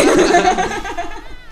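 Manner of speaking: big burst of laughter; loud high laugh. Real, not acted. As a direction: crack up laughing. Laughing loudly.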